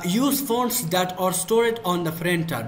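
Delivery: reads the option very slowly, word by word; slow reading aloud; slow dictation. Real, not acted. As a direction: spell it out slowly for the class. Reads an English menu option.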